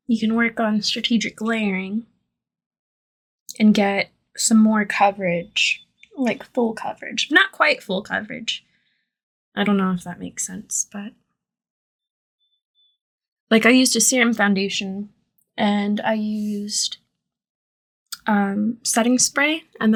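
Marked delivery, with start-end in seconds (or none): none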